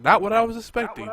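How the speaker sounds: high, loud surprised exclamation. Retold or imitated. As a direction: blurt it out in shock. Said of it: "Then you see the person, like".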